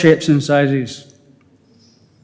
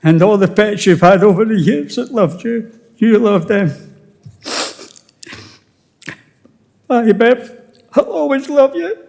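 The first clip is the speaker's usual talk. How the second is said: tearful farewell; 5 semitones higher than usual. Real, not acted. Farewell to late wife.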